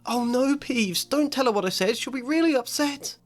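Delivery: put-on high, hurried girl's plea, not whispered; frantic plea. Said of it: "Hermione whispered frantically".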